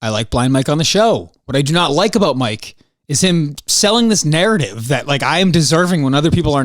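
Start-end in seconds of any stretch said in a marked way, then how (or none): none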